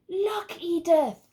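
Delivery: high, loud call to Edith; excited shout. Acted. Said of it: "shouted Arthur".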